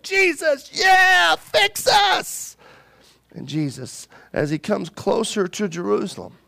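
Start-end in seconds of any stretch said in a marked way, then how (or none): 0.0-2.6 begging crowd. Retold or imitated. Crowd begs Jesus for help.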